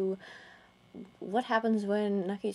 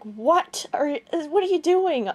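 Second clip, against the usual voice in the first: a jump up in pitch, louder and shocked; 6 semitones higher, 5 dB louder than usual. Retold or imitated. Retelling her shocked reaction to the fan's message, surprised and loud. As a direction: blurt it out in disbelief.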